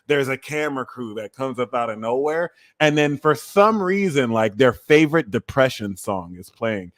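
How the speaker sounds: normal talking voice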